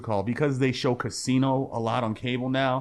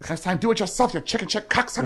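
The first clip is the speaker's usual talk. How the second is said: much higher, louder put-on James Woods rant; mock cursing out; 10 semitones higher, 3 dB louder than usual. James Woods cursing out Sharon Stone, high angry mock rant. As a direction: spit the insult like a furious husband. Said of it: "James Woods is cursing out".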